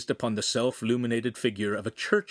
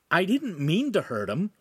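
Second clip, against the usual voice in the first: put-on high-pitched scared boy; mildly frightened, pitched up; 8 semitones higher than usual. A narrator's reading. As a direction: plead that it was an accident.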